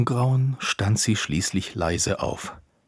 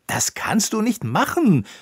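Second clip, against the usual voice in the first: high, loud protest; indignant raised-voice objection; outraged cry; 11 semitones higher, 5 dB louder than usual. A narrator's reading. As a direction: cry out against it, voice leaping up. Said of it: "rief Konicek".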